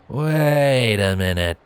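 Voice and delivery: drawn-out, dawning realization; slowly. A narrator's reading. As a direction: let it dawn on him, very slowly. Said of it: "he said slowly".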